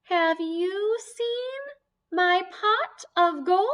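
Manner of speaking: high, loud, slow leprechaun pleading; squeaky leprechaun begging for his gold. Acted. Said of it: "sound like a leprechaun".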